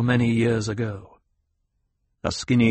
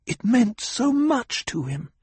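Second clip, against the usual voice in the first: high, imploring plea; earnest plea; pleading; 13 semitones higher than usual. Acted. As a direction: beg her teacher to understand. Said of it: "Jessica pleaded".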